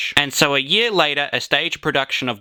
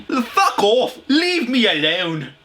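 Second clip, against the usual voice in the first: higher, hammed-up angry rant; Paul Joseph Watson rant; 8 semitones higher, much slower than usual. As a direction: rant like an online pundit. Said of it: "I sounded like Paul Joseph Watson".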